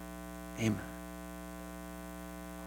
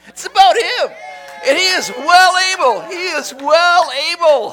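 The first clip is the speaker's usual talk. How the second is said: high, loud preacher's shout; preaching at full force; 6 dB louder than usual. Real, not acted. Pastor shouting praise to his congregation, full force. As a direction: lift it and shout it to the church.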